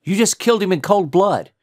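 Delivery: high, loud outraged accusation; shocked shout. A narrator's reading. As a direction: shout it in appalled disbelief. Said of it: "Then he was shouting at D J".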